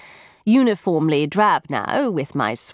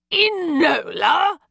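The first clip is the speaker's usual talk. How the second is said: slow, high astonished gasp of a name; stunned recognition, drawn out; 10 semitones higher, much slower than usual. A narrator's reading. Mycroft recognising his sister, gasping in shock. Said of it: "gasped Mycroft".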